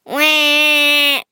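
loud, high, held "wah"; long storybook crying noise; mock baby wail. A narrator's reading. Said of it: "cried Angela".

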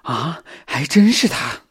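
slow, rising gasp of realisation; startled cry. A narrator's reading. Xiao Xin recognising the killer, startled cry. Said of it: "小新吃惊地叫道".

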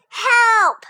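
high, loud cry of panic; cry for help. Acted. Toad cries out to Frog as his list blows away, a high loud cry. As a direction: yell it as the list flies off. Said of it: "cried Toad".

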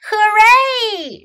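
joyful shout. A narrator's reading. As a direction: cheer out with delight. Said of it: "shouted Muslin".

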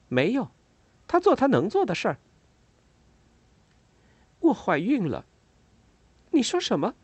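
high, lightly voiced women. A narrator's reading. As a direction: pitch the dialogue up.

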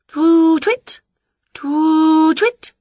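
loud, high hooting; witch's eager high owl impression; calling owl imitation. A narrator's reading. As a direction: call out like an owl. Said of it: "she called".

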